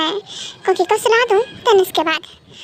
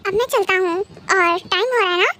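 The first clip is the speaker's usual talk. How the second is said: ordinary delivery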